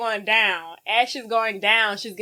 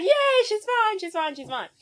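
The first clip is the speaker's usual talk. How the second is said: cheering; 13 semitones higher than usual. Retold or imitated. Crowd cheering for girl.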